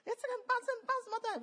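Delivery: very high sing-song enthusiasm; exaggerated high-pitched delight; coaxing a child. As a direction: imitate cooing over treats for a child. Coaxing a small child, retold.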